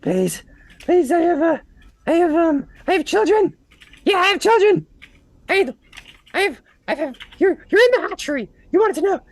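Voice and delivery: high, loud squeaky pleading; pleading kobold. Acted. Kobold begging for his children, high squeak. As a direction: stammer it out like a terrified little creature. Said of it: "He goes".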